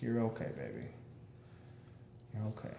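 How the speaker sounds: soft, hushed comfort for a pet; quietly calm the dog down; soothing a dog. Real, not acted.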